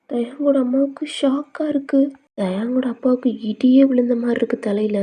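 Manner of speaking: plain reading voice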